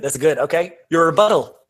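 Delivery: ordinary talk, nothing marked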